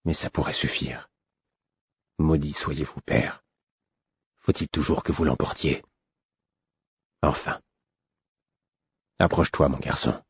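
soft, hushed and resigned; murmuring on. A narrator's reading. Vérité cursing his father under his breath, hushed murmur. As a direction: keep it low, half to yourself. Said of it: "murmura-t-il".

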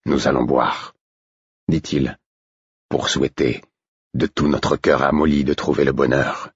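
a hint of the old stablemaster toasting; lightly voiced gruff old man.

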